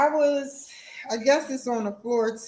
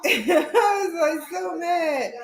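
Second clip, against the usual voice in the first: laughing high and loud about the anger; half laughing, half yelling; amused raised voice; laughing shout; 8 semitones higher, 4 dB louder, slower than usual. Real, not acted.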